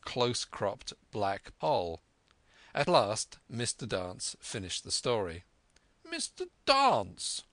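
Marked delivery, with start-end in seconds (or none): 6.1-7.4 high, slow, grand address; booming squire hailing a guest; hearty squire. A narrator's reading. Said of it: "said the squire".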